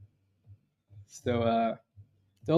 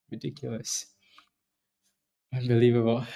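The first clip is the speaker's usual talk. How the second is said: usual speaking voice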